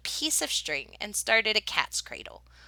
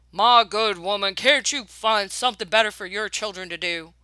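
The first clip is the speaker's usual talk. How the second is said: louder, different pompous man's voice; annoyed gentleman; 6 dB louder than usual. Acted. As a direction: scold her from your seat. Hooper glares, complains.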